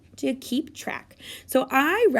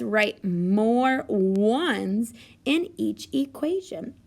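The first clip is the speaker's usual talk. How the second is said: slow reading; 2 semitones lower, much slower than usual. Shown as a demonstration. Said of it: "teacher reads directions slowly".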